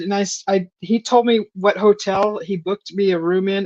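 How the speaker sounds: usual speaking voice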